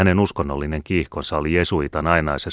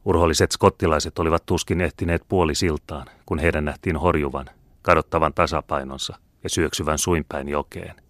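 ordinary delivery, nothing marked

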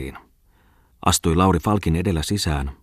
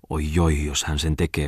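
usual speaking voice

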